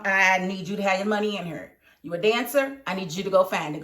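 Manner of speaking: ordinary delivery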